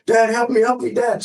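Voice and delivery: a father echoing his child's screams, mildly mocked; mild imitation of a terrified girl calling for her dad; retold frantic cry for help. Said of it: "She's screaming and hollering".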